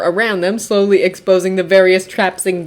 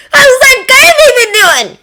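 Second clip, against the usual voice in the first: cheerful husband voice; 7 dB louder than usual. A narrator's reading. Narrator voices cheerful Hisashi.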